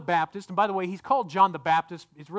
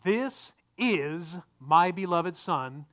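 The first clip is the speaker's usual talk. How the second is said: "This is" drawn out slowly, word by word; slow, weighty reading, setting "This is" apart; stress on "This is"; much slower than usual. Shown as a demonstration. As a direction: linger on "This" and "is". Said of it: "please take note of the next two words".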